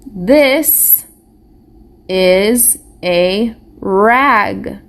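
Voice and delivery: "This is a rag" read slowly, one word at a time, with pauses; slow, separated reading of "This is a rag". Shown as a demonstration. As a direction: model each word for the class to echo. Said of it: "You're reading with me?".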